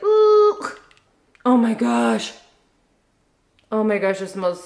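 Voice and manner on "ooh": high-pitched "ooh"; overloaded-brain noise. Acted. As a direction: imitate a brain short-circuiting with a high hoot. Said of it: "My brain is just like".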